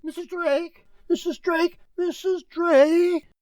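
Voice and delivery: a frightened servant calling from off; anxious servant calling out. Acted. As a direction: panicky butler shouting for the lady of the house.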